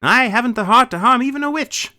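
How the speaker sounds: a loud, lifted tin man lament; Tin Woodman voice. A narrator's reading.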